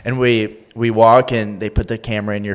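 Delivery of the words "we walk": ordinary delivery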